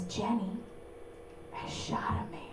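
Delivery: hushed confession whispered. Retold or imitated. Boyfriend whispering his confession in bed, hushed whisper. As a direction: breathe it like a dark secret.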